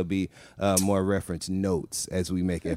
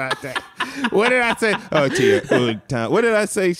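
put-on higher, laughing [name]-style accent; mock [name] accent, laughing; 10 semitones higher, 3 dB louder than usual. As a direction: stay in the accent while cracking up. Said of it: "This accent is killing me".

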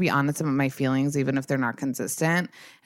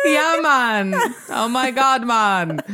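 high, louder hammed-up Jamaican "Yeah, man"; Jamaican accent; 7 semitones higher, 4 dB louder, slower than usual. Clowning in a Jamaican accent for her co-host. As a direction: play up a cartoon Jamaican accent. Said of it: "[name] with the Jamaican accent".

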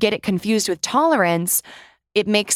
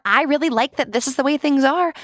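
higher, put-on approval; mock delight; 6 semitones higher than usual. Imitating someone who likes the way things are, mock pleased. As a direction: pretend to like it, pitched up a little.

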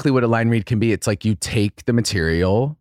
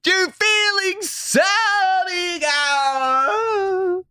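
high, drawn-out shouting; much slower than usual. Pretend. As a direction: belt it out high and slow.

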